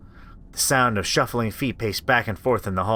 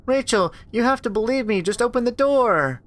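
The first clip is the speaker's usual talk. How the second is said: put-on high, thin child's plea; a little sister begging her big sister; pleading little girl; 12 semitones higher than usual. Little sister begging Rachel through the door, pleading.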